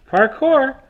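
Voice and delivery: a second, milder Parkour! call. Shown as a demonstration. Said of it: "I just wanted to show that routine".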